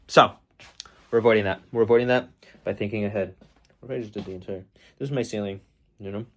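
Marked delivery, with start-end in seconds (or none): none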